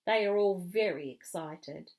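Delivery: ordinary delivery, nothing marked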